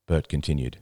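ordinary delivery, nothing marked

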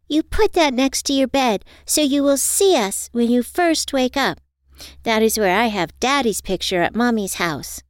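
put-on high, earnest child voice; little girl. A narrator's reading. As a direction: explain it like a small child. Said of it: "Asia explained".

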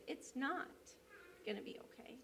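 a hint of a tearful woman, fading into a whisper; hopeless near-whisper. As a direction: let it sink away, barely voiced at the end. Crying woman answers hopelessly.

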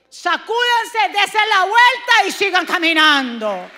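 loud, rising sermon delivery; preaching. Acted. Pastor preaching to the congregation, loud and fervent. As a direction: preach it with full force.

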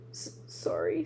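lightly voiced sobbing giant; sobbed. A narrator's reading. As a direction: stammer it out through tears. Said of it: "sobbed Hagrid".

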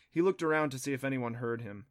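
plain reading voice

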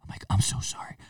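hushed, apologetic stage whisper; breathy-voiced secret apology under your breath. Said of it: "I'm whispering like this".

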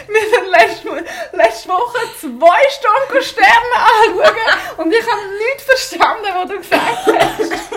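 loud, high, slow laughing yell; to someone hard of hearing. Acted. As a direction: stretch it out, shouting and laughing. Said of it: "du nur flüsterst".

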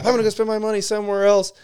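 high, quick mocking customer voice. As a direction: sneer her line back in a silly high voice. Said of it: "has the wrap".

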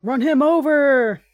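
1 urging shout. Real, not acted. Urging character at screen.

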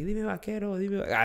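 piropo de burla, más agudo y más suave; imitando piropo callejero. Retold or imitated. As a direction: imita a los de la calle gritándole a la chava, en broma. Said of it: "toda la mara le empieza a gritar así". (imitación burlona de un piropo callejero)

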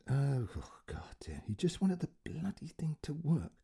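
put-on fed-up old man whispering; frustrated whisper. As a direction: breathe it out, at wits' end. Frustrated inner aside.

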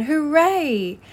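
high, loud cheer; jubilant cry. A narrator's reading. Rat makes a find in the snow, jubilant cry. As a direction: cry out in sudden triumph. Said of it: "Suddenly the rat cried".

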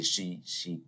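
normal talking voice

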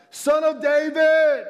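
high, drawn-out, full-voiced shout. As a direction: belt it out slowly, high and loud. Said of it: "but he only shouted louder".

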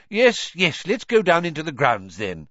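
high, loud, hurried; impatient. A narrator's reading. Fudge waving off the quarrel, impatient. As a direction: brush it off, impatient. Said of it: "said Cornelius impatiently".

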